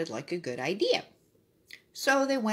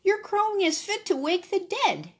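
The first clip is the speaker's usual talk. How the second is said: donkey remarking; 13 semitones higher, 5 dB louder than usual. A narrator's reading. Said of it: "said the donkey".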